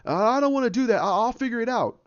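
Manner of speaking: high, lightly acted protest; Banner. Banner pinned, refusing Rocket, high mock protest. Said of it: "he goes".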